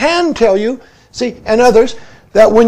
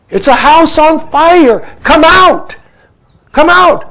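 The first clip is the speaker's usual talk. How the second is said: calling out; 6 semitones higher, 5 dB louder than usual. Retold or imitated. Calling out like the king.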